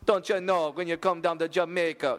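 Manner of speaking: high, mock Jamaican lilt; Jamaican accent. Host trying the requested accent, mock Jamaican. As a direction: sing out a butchered Jamaican accent. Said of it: "doing a drunk Jamaican accent".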